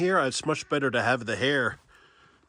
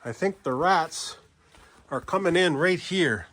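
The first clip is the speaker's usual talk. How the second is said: ordinary talk, nothing marked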